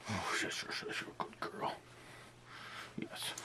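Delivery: hushed shushing to dog; whispering. Real, not acted. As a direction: whisper soothingly to the pet. Calming the dog.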